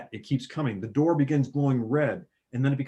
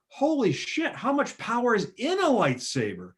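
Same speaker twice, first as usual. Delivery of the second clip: high, astonished viewer; amazed; 10 semitones higher than usual. Retold or imitated. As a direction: gasp it out in awe. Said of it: "you're thinking as a viewer".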